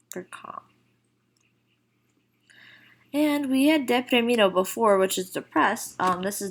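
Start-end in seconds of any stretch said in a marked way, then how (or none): 0.1-0.6 unvoiced whispered gloss, barely audible. Teacher to students, glossing calm in a whisper. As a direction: breathe the meaning out like a secret.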